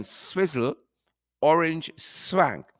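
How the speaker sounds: drawn out, savoring each word; slow relish. Real, not acted. Host to viewers, slow savoring drawl. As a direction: stretch it out, tasting the name. Relishing an odd drink name.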